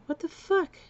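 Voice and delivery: high startled what-the; shocked disbelief. Retold or imitated. To the cops arriving, a high shocked exclamation. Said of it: "I'm like".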